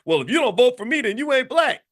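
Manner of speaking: imitating a politician losing composure; cracking. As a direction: snap it like he cracked under pressure. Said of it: "Biden just cracked and said".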